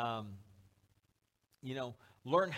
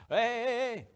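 loud, high-pitched parental call; calling back; 7 semitones higher, 6 dB louder, slower than usual. Acted. Parent stopping kid running into the mall, calling out. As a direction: call sharply after a runaway kid.

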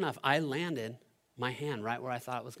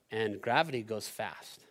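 ordinary talk, nothing marked

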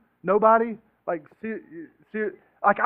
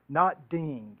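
ordinary delivery

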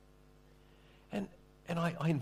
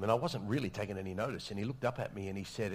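normal talking voice

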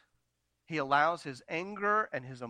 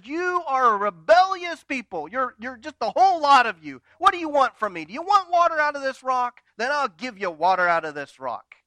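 higher, louder furious rant; angry tirade; 7 semitones higher, 8 dB louder than usual. Retold or imitated. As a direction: let the anger boil over at them. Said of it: "He is mad".

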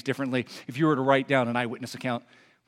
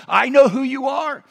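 higher, louder mock cry; demon crying out; 8 semitones higher, 5 dB louder than usual. Demon confronted by Jesus, crying out in recognition. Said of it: "that demon cries out and says".

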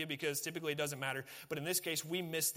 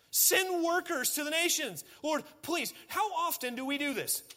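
fervent preaching climax, voice pitched way up; impassioned pleading sermon; 8 semitones higher, 4 dB louder than usual. Acted. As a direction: cry out to the congregation to beg God. Pastor pleads for the lost.

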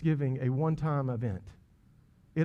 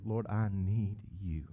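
soft, slow whisper of a humble prayer; preacher modeling a humble prayer, whispered; 7 semitones lower, 8 dB quieter, slower than usual. Pretend. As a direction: pray it barely aloud. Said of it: "honor this prayer".

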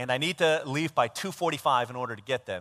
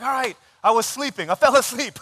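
high, loud admission of guilt; retold exasperated owning up; cornered confession; 8 semitones higher, 9 dB louder than usual. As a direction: give up and blurt out the truth.

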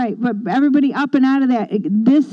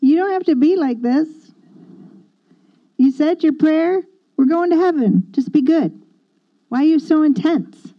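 higher-pitched, protesting voice; normal churchgoer; 7 semitones higher than usual. Retold or imitated. Normal churchgoer to the intense newcomer, higher mock protest. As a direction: lightly mimic your former churchgoing self objecting. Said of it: "so I'm the normal churchgoer".

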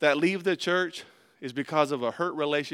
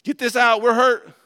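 higher, louder urgent order, building; the head ordering a scream; mock command to scream; 7 semitones higher, 9 dB louder than usual.

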